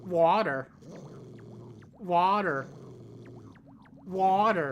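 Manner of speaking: Jersey accent on "water"; "water" said the New Jersey way, round and drawn. Real, not acted. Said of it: "Why are you saying it like that?".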